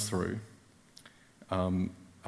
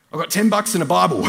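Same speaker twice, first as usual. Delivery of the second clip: much higher and louder, triumphant; excited boast; 9 semitones higher, 12 dB louder, faster than usual. Retold or imitated. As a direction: crow it proudly to the car. James boasting, running to car.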